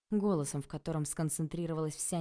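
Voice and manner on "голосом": ordinary delivery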